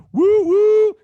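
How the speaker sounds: loud, high-pitched "woo, woo"; celebratory whoop. Real, not acted. Host hyping the merchandise reveal, celebratory whoop. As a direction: whoop it up for the crowd.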